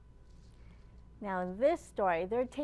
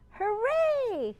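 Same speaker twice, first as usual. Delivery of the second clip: big high cheer, louder; 11 semitones higher, 8 dB louder than usual. Acted. Librarian leading kids, cheering hooray loud and high.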